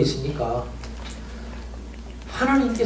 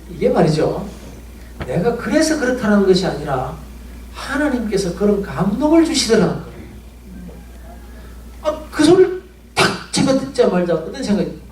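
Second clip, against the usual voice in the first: ordinary delivery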